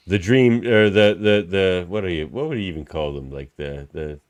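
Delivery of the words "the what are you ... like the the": ordinary talk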